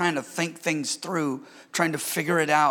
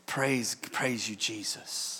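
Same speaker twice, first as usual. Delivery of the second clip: softer, slow, hushed praise; soft murmured prayer; 8 semitones lower, 10 dB quieter, slower than usual. Retold or imitated. Pastor recalling praising Jesus alone, slow hushed murmur. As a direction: breathe it out like a private prayer. Retold spontaneous praise at home.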